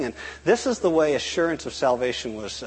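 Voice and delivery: ordinary talk, nothing marked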